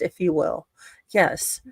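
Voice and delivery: usual speaking voice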